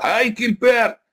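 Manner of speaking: hammed-up prophet denouncing a man in church; mock prophet rebuke. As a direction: call out Gilbert like a stern prophet, then slow the last line. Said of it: "Anda kalau ngomong gitu sama Gilbert".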